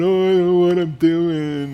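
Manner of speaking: loud, high hammed-up wail of despair; mock writer's despair. Partner teasing about writing drama, loud mock wail. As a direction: ham up a writer rolling on the floor in despair. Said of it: "rolling on the ground going".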